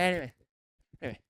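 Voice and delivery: ordinary talk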